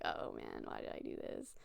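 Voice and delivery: low, hushed mock dismay; retold self-reproach under the breath; muted regret. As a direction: sink into a quiet, regretful murmur. Retold regret after awkward trip.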